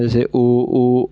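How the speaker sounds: उ then ऊ said slowly with rounded lips; उ, ऊ drawn out with rounded lips; rounded vowel demo. Shown as a demonstration. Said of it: "इनके उच्चारण में ओठ गोलाकार हो जाते हैं".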